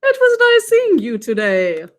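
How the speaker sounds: lightly voiced puppet, high sing-song farewell; puppet goodbye. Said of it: "Say bye, Mister Bones".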